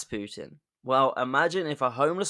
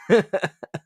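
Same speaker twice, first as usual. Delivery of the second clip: high, loud burst of giggles; cracking up; amused laughter; 7 semitones higher, 5 dB louder than usual. Real, not acted. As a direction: burst out laughing at your own impression. Cracking up at own impression.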